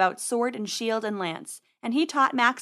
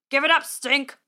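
raised, loud command; loud, higher-pitched order; constables' yell; 4 semitones higher, 5 dB louder than usual. A narrator's reading. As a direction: call out a demand to surrender. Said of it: "yelled the other three constables".

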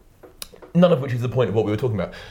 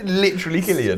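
laughing; 9 semitones higher than usual. Real, not acted. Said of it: "You can't pronounce anything".

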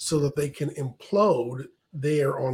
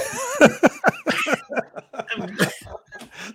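high burst of laughter; loud laugh; 12 semitones higher, 3 dB louder than usual. Real, not acted. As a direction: break out laughing.

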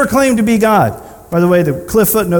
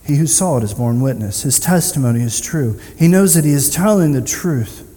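lower, measured reading voice; solemn scripture reading; 7 semitones lower, 3 dB quieter than usual. Acted. Preacher reading the crucifixion account, solemnly. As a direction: read it gravely to the congregation. Reads scripture passage aloud.

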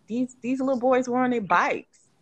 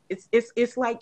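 normal talking voice

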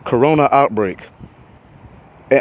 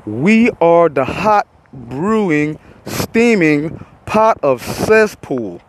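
drawn-out emphasis; 3 semitones higher, much slower than usual. Real, not acted. Ranting about Florida covid numbers.

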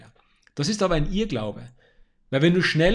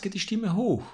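"geht die Stimme hoch" lifted higher, as at a comma; voice going up; 6 semitones higher than usual. Shown as a demonstration. Speaking coach showing the voice rising at a comma. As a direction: raise the pitch on "hoch" like mid-sentence. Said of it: "Wenn es einen Beistrich gibt".

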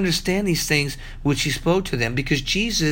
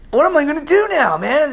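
mock panic; 8 semitones higher, 3 dB louder than usual. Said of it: "I'm like".

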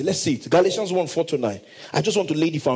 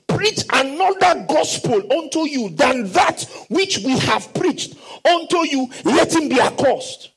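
preached shout; 7 semitones higher than usual. Acted. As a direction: thunder the curse from the pulpit. Preaching scripture aloud.

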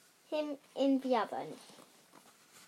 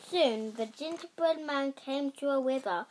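ordinary delivery, nothing marked

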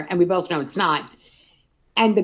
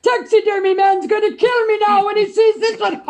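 higher, louder put-on voice, showing off a catch; hammed-up movie quote; 12 semitones higher, 6 dB louder than usual. Fisherman bragging about his catch to the crowd, hammed up. Quoting movie line in character.

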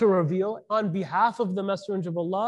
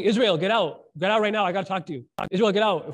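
loud, insistent mock yell; retold screaming; 5 dB louder than usual. Caller demanding Israel come out to talk, mock yell. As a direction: holler as if calling someone out of a house. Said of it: "starts screaming".

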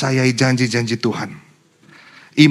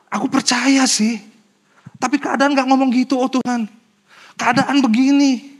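doubting believer; 10 semitones higher than usual. Retold or imitated. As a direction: whine it out, pitched high. Voicing a doubting believer's complaint.

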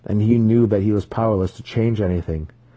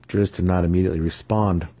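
ordinary delivery

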